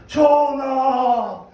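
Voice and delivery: long, drawn-out loud "Your Majesty"; loud, slow mock-courtly cry; calling out. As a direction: call to the king, stretching every syllable. Priests call out to the king.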